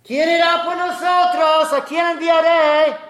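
voz alta, lenta y resonante; llamado de Dios. Acted. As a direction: proclama como un llamado divino. (Dios que busca enviados, llamado fuerte y lento)

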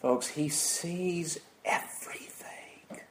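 very slow stage whisper; hushed awe. Real, not acted. Preacher telling folks God sees all, slow hushed whisper. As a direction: whisper it, drawing out each word.

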